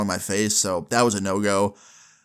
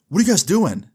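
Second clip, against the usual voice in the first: raised, loud challenge; wary stranger; 7 semitones higher, 4 dB louder than usual. Retold or imitated. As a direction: call out the question loudly. Stranger confronts them.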